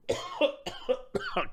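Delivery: sore-throat cough, strained and high; rough cough. Real, not acted. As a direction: hack out a dry cough. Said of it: "My throat's all sore".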